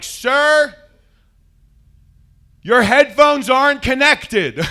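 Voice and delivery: high, loud and slow, announcing to the whole plane; loud flight attendant. Retold or imitated. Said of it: "she's, like, very loud too".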